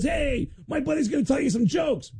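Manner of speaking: mock lazy Lars intro. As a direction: introduce a friend, mocked. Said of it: "he goes".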